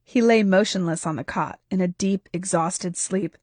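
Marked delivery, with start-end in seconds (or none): none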